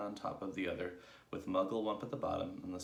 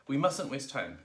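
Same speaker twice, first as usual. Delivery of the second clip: loud, raised urging; urgent cry; 7 semitones higher, 7 dB louder than usual. A narrator's reading. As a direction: cry out to hurry the team. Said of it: "cried Mugglewump".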